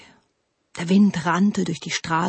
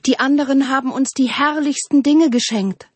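higher, louder chorus of protest; resounding call; 7 semitones higher, 5 dB louder than usual. A narrator's reading. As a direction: ring out like many trees calling. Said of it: "schallte es von allen Seiten". (laut schallend)

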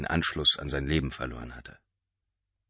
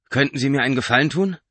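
higher, louder Mark voice; asking a favour; 8 semitones higher, 7 dB louder, faster than usual. A narrator's reading. Mark asking the taxi driver a favour, higher voice. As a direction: ask politely but urgently.

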